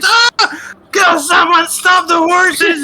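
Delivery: high, frantic cries for help; screaming passengers in a runaway wagon; panicked passengers. Acted. Terrified passengers crying out as horses bolt, high panicked yell.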